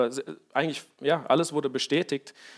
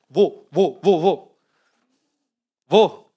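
louder, frantic questions; panicked searching; 4 dB louder, slower than usual. Acted. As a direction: look around in alarm for the army. (panisch suchend, lauter)

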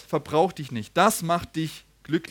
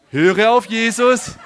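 loud, slow, raised proclamation; emphatic preaching; 4 semitones higher, 11 dB louder, slower than usual. Real, not acted. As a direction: drive the key line home to the congregation. Preacher hammering the sermon's key line.

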